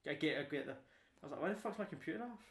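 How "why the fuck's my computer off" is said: retold furious outburst, higher; raging about the computer; angry. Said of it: "I was raging".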